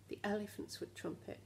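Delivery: ordinary delivery, nothing marked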